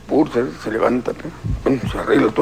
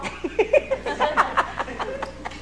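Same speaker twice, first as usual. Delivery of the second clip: squeaky laugh; 8 dB quieter than usual. Acted. Risa tras el remate.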